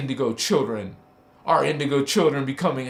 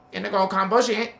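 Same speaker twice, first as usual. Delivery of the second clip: parrot voice; 3 semitones higher, much faster than usual. Pretend. A hint of parrot mimicking for viewers. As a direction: rattle it off like a mock parrot. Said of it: "I can't talk like a parrot".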